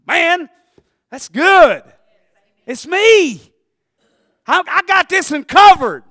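high, loud bursts; fired-up pulpit exclamations; exultant preaching. Real, not acted. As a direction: holler each short phrase with pauses between. Preacher exults over his point.

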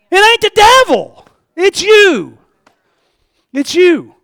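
very high, full-voiced preacher's shout; shouted rebuke. Real, not acted. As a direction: hurl the accusation at the congregation, drawing out each phrase. Preacher's shouted rebuttal at climax.

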